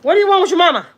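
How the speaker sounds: high loud yell at the dog. Real, not acted. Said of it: "here he knows I'm yelling".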